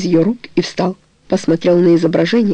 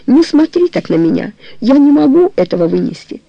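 higher-pitched, mildly agitated pleading; distressed plea; 6 semitones higher than usual. A narrator's reading. Woman begging her lover not to look at her so, mildly distressed. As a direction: plead with him, voice lifted.

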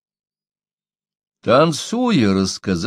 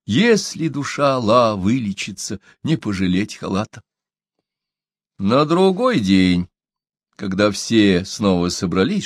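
plain reading voice

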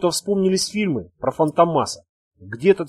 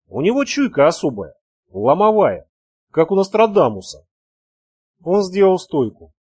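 plain reading voice

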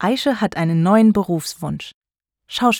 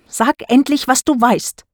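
firm, raised, forceful demand; energetic; 5 semitones higher, 4 dB louder than usual. A narrator's reading. Konstanze pressing Daniel for what he knows, energetic. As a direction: push hard for an answer, pitch up. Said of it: "sagt Konstanze energisch".

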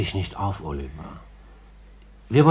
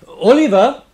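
high, loud call across the house; 13 dB louder than usual. Acted. Gentleman to boy in another room, calling loudly. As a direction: call him from the next room.

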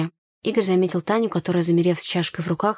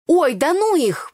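high, louder, drawn-out brush-off; wincing dismissal; 9 semitones higher, 5 dB louder, slower than usual. A narrator's reading. Tanya dismisses her friends after a quarrel, exasperated. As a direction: wave them away with exasperation. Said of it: "поморщилась Таня".

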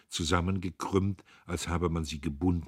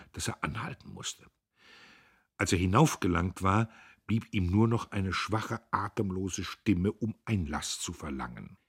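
ordinary delivery, nothing marked

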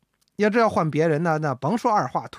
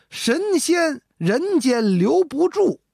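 very slow, high, drawn-out poem recitation; recited verse; 8 semitones higher, 3 dB louder, much slower than usual. Acted. Ji Xiaolan saves himself with a new line for the emperor, very slow and high. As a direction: stretch each word of the verse out grandly.

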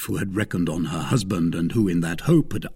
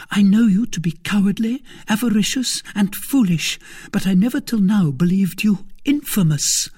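high, louder, emphatic list of insults; angry wife; 12 semitones higher, 5 dB louder than usual. Acted. Wife in a passion denouncing her husband, angry. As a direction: denounce him with mounting contempt.

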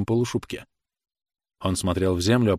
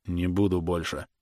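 softer, dropped-voice refusal; sullen; 3 semitones lower, 5 dB quieter than usual. A narrator's reading. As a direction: refuse glumly, voice sinking. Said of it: "угрюмо сказал". (угрюмо, тихо)

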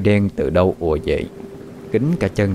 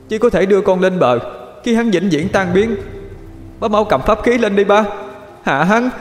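put-on wailing child; 11 semitones higher than usual. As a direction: beg dad through tears. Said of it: "Thằng Quy gào lên khóc". (Quy gào khóc van xin ba)

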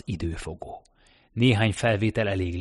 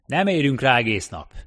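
loud, higher impatient foreman; raised voice; 5 semitones higher, 6 dB louder than usual. A narrator's reading. Foreman hurrying workers to the lift, raised voice. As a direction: push the dawdling crew along loudly. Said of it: "emelte fel a hangját a munkavezető".

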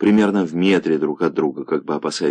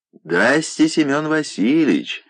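booming call; 10 semitones higher than usual. A narrator's reading. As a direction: hail him across the yard, rolling. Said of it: "раскатисто закричал с крыльца". (раскатистый приветственный крик)

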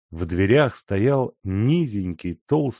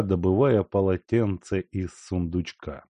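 ordinary delivery

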